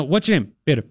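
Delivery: quick, offhand name exchange; demonstrating a hurried question and answer; really quick. Shown as a demonstration. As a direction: say it in a rapid mumble-free rush. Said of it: "if somebody says really quick".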